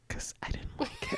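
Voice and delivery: mock hushed whisper, quoting a friend; imitated whisper. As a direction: whisper it back teasingly. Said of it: "You said, you said".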